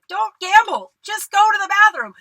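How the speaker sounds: high, loud, urgent warning to herself; self-scolding. Retold or imitated. Scolding herself for taking the gamble, loud and urgent. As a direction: yell it like a stern order.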